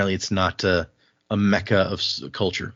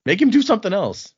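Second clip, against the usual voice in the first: high, louder mock outrage over his beer; offended drunk; 5 dB louder than usual. Bar patron balks at losing his beer, high mock outrage. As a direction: squeal in indignant protest. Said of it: "And the guy's like".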